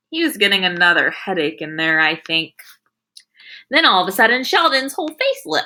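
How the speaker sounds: ordinary delivery, nothing marked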